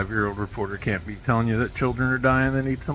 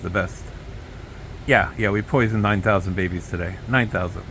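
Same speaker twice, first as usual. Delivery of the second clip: ordinary delivery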